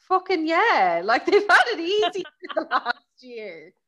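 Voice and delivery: loud, high-pitched mock outrage; indignant outburst. Reacting to headline about police challenges, loudly indignant.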